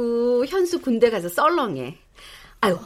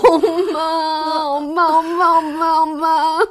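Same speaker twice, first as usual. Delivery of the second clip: higher, repeated "엄마" with laughter; joyful calling; 6 semitones higher, 3 dB louder, much slower than usual. Acted. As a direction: call her again and again in delight. Calling mother repeatedly, overjoyed. (기쁨에 겨운 부름)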